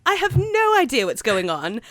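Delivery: high, put-on British accent; bad London accent. Host baffled by the film trailer, bad London accent. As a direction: play up a poor London accent. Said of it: "talk in a bad accent".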